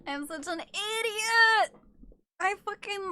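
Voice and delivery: high, slow laugh-crying; cracking up in embarrassment; wailing through laughter; laughing at herself. Real, not acted. Embarrassed at own mistake.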